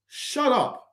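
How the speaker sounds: dismissive. Real, not acted. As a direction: brush him off with a sneer.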